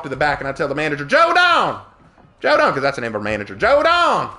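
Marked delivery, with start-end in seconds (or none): 1.0-2.7 high, loud Southern holler; shouted call. Retold or imitated. Server hollering to the manager in the back, shouting. 3.5-4.3 high, loud Southern holler; shouted call. Retold or imitated. Server hollering to the manager in the back, shouting.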